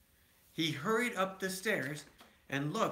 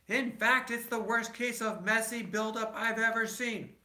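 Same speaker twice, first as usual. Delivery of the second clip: louder, higher scolding; Papa scolding the family, raised voice; Papa yelling; 8 semitones higher, 4 dB louder than usual. A narrator's reading. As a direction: give them a proper telling-off. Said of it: "Here's Papa yelling".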